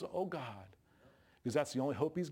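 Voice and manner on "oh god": soft, low final gasp of a word; last dying breath. Retold or imitated. Dying man's last soft breath to God. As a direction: let it fade out like a last breath. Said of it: "his last dying breath was".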